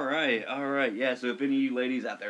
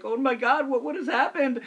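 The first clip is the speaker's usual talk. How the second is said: much higher, louder imitation Romanian accent; mock-panicked foreign accent, pitched way up; panicked Romanian lifeguard; 11 semitones higher, 4 dB louder than usual. Lifeguard learns of revoked visa, imitated in high panicky Romanian accent. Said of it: "He's the Romanian lifeguard there".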